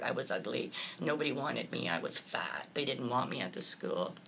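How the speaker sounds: low, soft and choked, reading out the cruel note. Real, not acted. Recalling the cruel note for the interviewer, choked up. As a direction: voice sinking as the hurt comes back. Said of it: "Chokes me up even talking about it".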